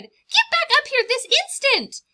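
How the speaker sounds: very high, loud scolding; Mama Bird scolding the chicks hanging off the nest; scolding mother bird. Acted. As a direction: yell at them to get back.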